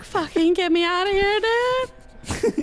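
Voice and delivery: climbing, exasperated mock-dog voice; voicing the dog. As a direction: play a dog pleading to escape. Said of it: "She's like".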